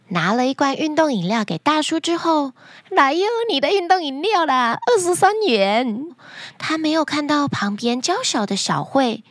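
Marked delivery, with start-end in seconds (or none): 2.9-6.1 high, singsong shop lady voice; shopkeeper woman. Acted. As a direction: serve the customer in a high vendor's lilt. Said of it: "老板娘走了出来".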